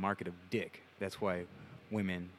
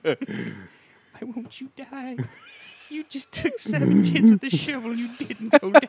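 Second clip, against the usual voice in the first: high, drawn-out wail building to a loud shout; play-acted desperate yelling; mock frantic attacker; 15 dB louder, slower than usual. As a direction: protest in strained disbelief. Acts out husband swinging shovel.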